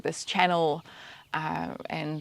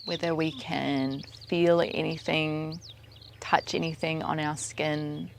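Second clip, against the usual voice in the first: soft, even meditation voice, spaced out with pauses; quiet, unhurried mindfulness cueing; guided meditation; 6 dB quieter than usual. Acted. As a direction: guide their attention gently through the senses. Leads a meditation.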